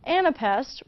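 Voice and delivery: normal talking voice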